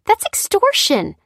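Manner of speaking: high, loud, indignant rant; outraged cry. A narrator's reading. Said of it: "cried Lucy".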